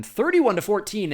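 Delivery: loud, high score announcement; excited announcer hitting the score; emphatic score call. Real, not acted. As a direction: proclaim the halftime score with force. Commentator announces halftime score.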